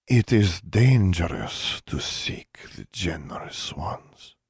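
slow, whispery warning; breathy, lingering, fading at the end; slowly. A narrator's reading. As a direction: draw it out in a whisper. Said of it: "the woman said slowly".

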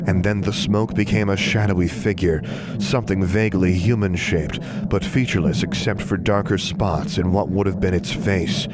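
ordinary delivery

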